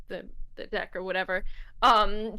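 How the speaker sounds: normal talking voice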